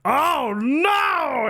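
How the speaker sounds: high, loud mock alarm. Streamer recalls hearing Marjorie Greene, in mock alarm. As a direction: squeal it like mock panic. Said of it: "I was like".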